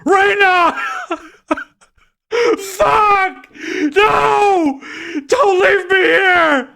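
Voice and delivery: very high, loud, slow sobbing cries; anguished crying. A narrator's reading. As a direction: wail her name in grief. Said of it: "he cried".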